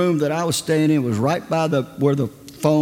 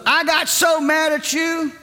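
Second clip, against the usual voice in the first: high, eager retelling; woman's excited testimony; 9 semitones higher than usual. Retold or imitated. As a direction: tell it high and breathless with joy. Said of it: "She goes".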